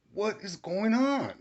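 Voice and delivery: high, drawn-out outraged question; appalled disbelief. Retold or imitated. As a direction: stretch it out slowly in shocked protest. Horrified at sight of wife assaulted.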